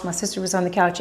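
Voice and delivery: ordinary talk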